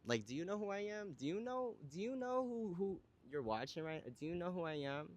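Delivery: high, sassy do you know who I am; sassy diva. Pretend. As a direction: play the diva demanding recognition. Mock diva challenge to viewers.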